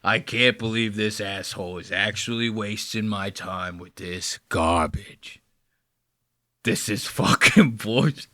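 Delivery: low, soft, fed-up grumble; imitated disgusted disbelief. Host voicing Dave fed up with the pitch, low disgusted grumble. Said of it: "Dave's over here like".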